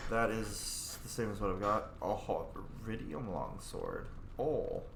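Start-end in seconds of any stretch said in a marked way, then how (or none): none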